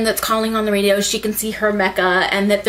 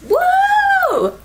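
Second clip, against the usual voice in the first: high loud whoop, then a laugh; real excitement; 5 dB louder than usual. Real, not acted. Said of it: "I'm excited".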